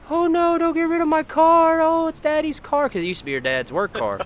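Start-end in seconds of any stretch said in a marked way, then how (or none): 0.1-2.9 put-on very high wailing little-girl voice; mock bawling. Little girl begging to keep Daddy's car, mock bawling. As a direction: imitate a small child's distraught wail. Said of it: "she just starts bawling".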